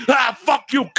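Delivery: a high hammed-up yell of fuck off; mock angry yell. Son yelling at his dad over loud music, mock furious. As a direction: bark it up high like a furious teen. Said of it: "and you're like".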